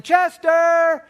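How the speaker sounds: put-on high, loud call of an eager boy; kid yelling. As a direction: shout the dog's name like a thrilled kid. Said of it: "he's yelling".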